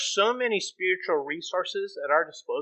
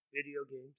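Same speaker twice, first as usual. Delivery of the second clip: tiny, very soft mock confession; acted small, hushed admission; sheepish answer; 3 semitones lower, 17 dB quieter than usual. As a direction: answer God under your breath. Kid sheepishly answering God.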